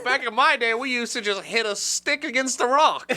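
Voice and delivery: lightly voiced old grandpa, high; a hint of an old timer. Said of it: "You sound like a fucking grandpa".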